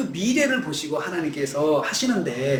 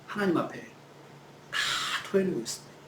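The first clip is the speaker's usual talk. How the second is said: hushed; 9 dB quieter than usual. Shown as a demonstration. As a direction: drop to a hush, drawing the words out. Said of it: "짜증과 분노". (숨죽여 낮게 속삭이듯)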